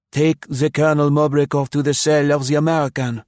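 pompous governor issuing a command; governor ordering. A narrator's reading.

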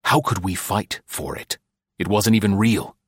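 ordinary delivery, nothing marked

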